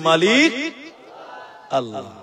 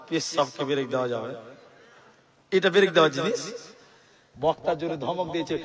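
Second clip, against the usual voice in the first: ordinary talk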